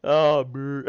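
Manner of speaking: squealing high laughter; high laughing fit. Real, not acted. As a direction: cackle out loud, high. Cracking up at co-host.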